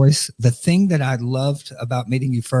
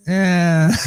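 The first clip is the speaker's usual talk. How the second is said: indifferent shrug; 6 semitones higher than usual. Shown as a demonstration. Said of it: "It just means like".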